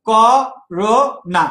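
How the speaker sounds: করোনা stretched very slowly, syllable by syllable. Shown as a demonstration. Teacher stretches a word so students count syllables, very slowly. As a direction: draw out করোনা beat by beat. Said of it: "লক্ষ্য করো".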